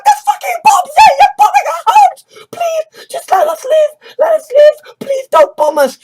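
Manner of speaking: high, loud panicked crying plea; terrified pleading. Acted. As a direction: beg for your life, voice cracking. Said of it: "the refugees are terrified because they're like".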